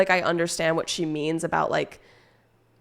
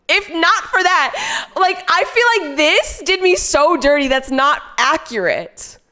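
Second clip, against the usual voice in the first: indignant protest; 9 semitones higher, 5 dB louder than usual. Real, not acted. Streamer blaming the pronunciation guide, high indignant wail. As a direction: wail that you've been wronged. Protesting she was misled.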